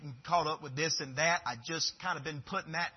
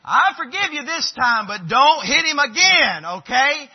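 emphatic forgiveness; 9 semitones higher, 14 dB louder than usual. Retold or imitated. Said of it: "dad forgiving child".

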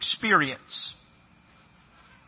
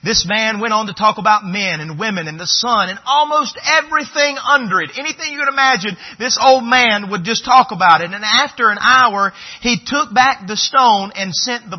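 preaching; 4 semitones higher, 6 dB louder than usual. Acted. Preacher retelling parable.

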